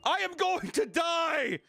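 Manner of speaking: high, slow, strained yell; hammed-up scream of a dying man; mock dying yell. Retelling the rider's last words, a hammed-up yell.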